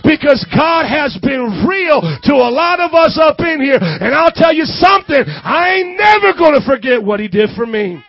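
loud, full-force testimony; impassioned preaching. Real, not acted. Preacher testifies of God healing broken young people, impassioned. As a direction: preach it with rising fervor, then urge them.

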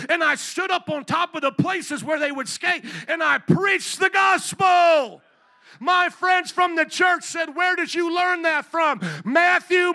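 high, shouted sermon delivery; full-force preaching. Real, not acted. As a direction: belt it out to rouse the congregation.

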